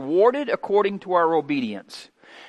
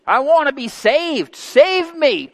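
pleading cry; 8 semitones higher, 3 dB louder, slower than usual. Retold or imitated. Voicing believers' cry.

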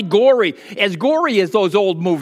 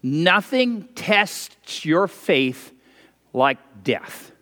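very slow, with a pause before like death; slow, grave repetition; slower repeat; much slower than usual. Shown as a demonstration. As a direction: lay each word down slowly. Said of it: "I'll say that again".

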